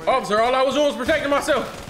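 high, loud protest; loud pleading. Acted. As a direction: shout your innocence. Pleading after shooting.

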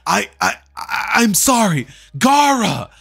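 high, loud panicked begging, rising to a cry; terrified pleading. Acted. Kankuro, frozen in fear, begs and cries out to Gaara. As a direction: beg for your life, voice climbing in terror. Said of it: "frozen in fear".

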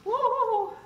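excited. Real, not acted. Cheering while cooking.

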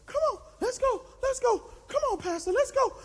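high, rapid-fire coach chant; trainer's voice. Acted. Trainer driving the flagging pastor on, high and insistent. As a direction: egg them on in a high voice. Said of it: "He says".

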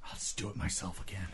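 softly muttered resignation; muttered aside. Retold or imitated. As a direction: grumble it under your breath.

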